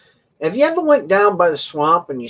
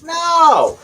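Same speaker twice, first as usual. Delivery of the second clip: high, loud burst; excited shout; 13 semitones higher, 6 dB louder than usual. Real, not acted. Bursting out to tell viewers the knee trick, loud. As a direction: cut in with a sudden loud no.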